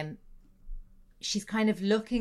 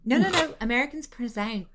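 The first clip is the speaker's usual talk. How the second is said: ordinary talk, nothing marked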